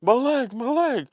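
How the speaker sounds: high, loud cartoon cry of pain; Fred. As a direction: yelp it like a cartoon victim.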